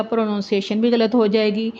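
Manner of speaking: ordinary talk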